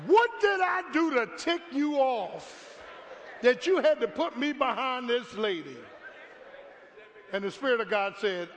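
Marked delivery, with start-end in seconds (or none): 0.1-5.8 high and loud whiny complaint; mock plea to heaven. Pastor stuck behind slow shopper, hammed-up whining plea to God. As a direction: wail it up to the Lord, hammed-up.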